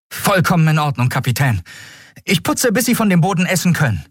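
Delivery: put-on high-pitched, zealous boy reporting to his captain; eager new recruit. A narrator's reading. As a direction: answer keenly in a high young recruit's voice. New boy eagerly accepts the chore.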